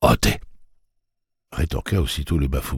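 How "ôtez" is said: a short, low bark of a word; low barking creature. A narrator's reading. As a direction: bark it like a hoarse beast. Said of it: "une voix basse et profonde, proche de l'aboiement".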